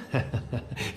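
scornful laugh. Acted. Holmes laughs at the accusation.

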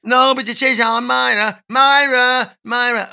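high, loud soap-opera northern woman; Coronation Street woman. Acted. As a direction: wail it like a Coronation Street gossip. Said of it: "It's almost Coronation Street".

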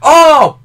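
high, very loud "oh" of realisation; loud exclamation. Real, not acted. Remembering the ship's name, loud exclamation. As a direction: burst out with a sudden "oh".

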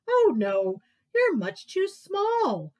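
loud, slow amused elephant. Acted. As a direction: let him down gently.

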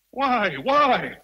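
tormented shout repeated; anguished cry. Acted. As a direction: cry out in disbelief and pain. Rochester desperate at her refusal.

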